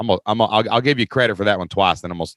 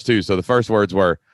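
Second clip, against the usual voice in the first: usual speaking voice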